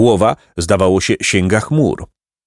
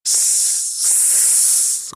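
long, soft snake hiss; quiet venomous hiss; 9 dB quieter than usual. A narrator's reading. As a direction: hiss softly and steadily like a snake. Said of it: "ciche, jadowite syczenie".

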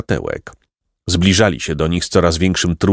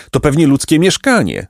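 higher-pitched, mildly joyful cry; joyful call; 7 semitones higher than usual. A narrator's reading. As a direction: call out with a touch of joy. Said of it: "zawołał radośnie Placek".

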